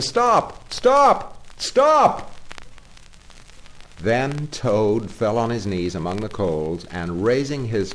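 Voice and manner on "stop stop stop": high, loud rising shouts; shouting Stop. Retold or imitated. Driver imitating the pursuers' shouting, high and loud. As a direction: ring out Stop each time higher. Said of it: "all shouting the same thing".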